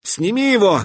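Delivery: a high commanding shout. Acted. Katya orders the stalker to drop the pack, shouting. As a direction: order him to take it off. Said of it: "крикнула Катя".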